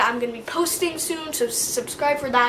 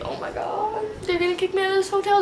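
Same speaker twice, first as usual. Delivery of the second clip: high, rushed panicky inner voice; fast, frantic self-talk; mock panic; 6 semitones higher than usual. Panicking about being kicked out.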